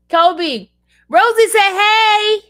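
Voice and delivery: high, loud chirpy call to the dog; excited high call. Real, not acted. Telling Kobe that Rosie said hey, loud high chirp. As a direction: squeal the news to the dog.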